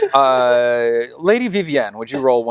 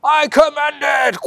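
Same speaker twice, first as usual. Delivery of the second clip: very high shouted verdict with a whack; fanatic's shout; 4 dB louder, slower than usual. Acted. Fanatic shouts as he whips the accused. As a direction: strike as you yell.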